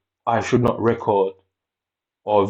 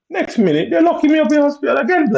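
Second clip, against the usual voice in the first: very high, squealed mock outrage; high-pitched mock wail; 4 dB louder than usual. Telling viewers of being locked up again, high mock wail. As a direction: squeal it in exaggerated, loud disbelief.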